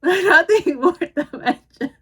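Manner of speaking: laughing out the line, high and loud; breaking into laughter mid-role; can't keep a straight face. Real, not acted.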